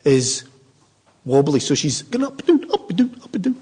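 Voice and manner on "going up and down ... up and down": high bobbing sing-song. Retold or imitated. Telling how his mother wobbled, in a bobbing high voice.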